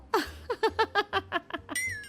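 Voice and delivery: high, long gossipy cackle; mocking laughter. Women laughing at Saroj's parenting, mocking cackle.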